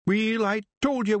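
Rob scolding his brother in broad Scots; exasperated Scottish pictsie; Scottish chieftain. Acted. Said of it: "said Rob".

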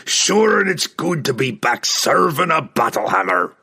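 high, loud exultant dwarf; gleeful shouting. A narrator's reading. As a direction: whoop it out, thrilled to be fighting. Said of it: "he screamed in glee".

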